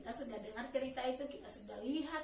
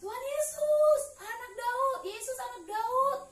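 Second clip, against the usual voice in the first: acted cry out; 10 semitones higher, 6 dB louder than usual. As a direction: call out for mercy as the blind man, high and loud. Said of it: "Lalu dia berteriak kan". (berseru memohon dengan nada tinggi)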